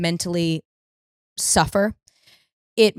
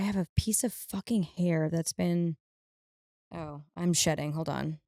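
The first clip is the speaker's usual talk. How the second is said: quiet off-mic aside; muttered aside; 10 dB quieter than usual. Real, not acted. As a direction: mutter it to yourself. Aside, hair in eye.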